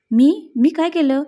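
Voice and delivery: loud incredulous "me?", playing innocent; feigned innocence. A narrator's reading. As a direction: act taken aback, pitch jumping up. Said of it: "काही न केलेल्या आविर्भावात विचारलं".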